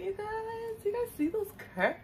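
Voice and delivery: squeaky, hiccuping delight; hiccups. Real, not acted. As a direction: voice jumping with hiccups. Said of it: "I have the hiccups".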